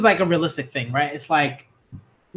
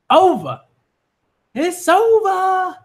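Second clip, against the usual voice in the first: high, with "It's over" drawn out slowly; triumphant; 4 dB louder, much slower than usual. Real, not acted. A fan declaring the fight won, in a high, slow, triumphant voice. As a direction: gloat that it is finished.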